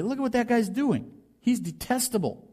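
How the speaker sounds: scornful church voice. Retold or imitated. Church voice condemning the prodigal.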